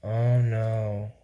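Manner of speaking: low, soft, hammed-up "Oh no"; mock concerned. Showing off her most concerned face, mock dread. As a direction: put on an exaggerated worried face and sigh it out. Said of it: "my most concerned face".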